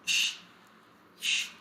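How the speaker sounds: "sh" as a drawn hushing sound; "sh" on its own, voiceless; sh sound demo. Shown as a demonstration. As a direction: say "sh" as an isolated consonant. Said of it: "C H is going sound of".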